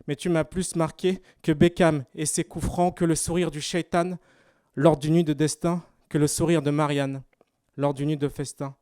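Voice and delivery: spoken-word elegy, projected above chatting voice; steady, measured verse for someone lost; elegiac slam poetry. Acted. Poet addressing a late project leader, measured elegiac recitation.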